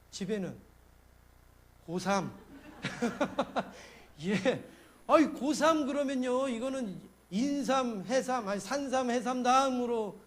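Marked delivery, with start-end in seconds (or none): 5.1-10.2 loud, slow and amused; milk the pun while laughing; chuckling through the wordplay; laughing joke. Real, not acted. Joke about high-school senior.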